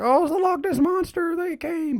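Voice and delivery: very high mock-panicked cry; exaggerated high-pitched impression; imitating Scooby and Shaggy. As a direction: squeal it like scared cartoon sidekicks. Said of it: "Scooby and Shaggy are like".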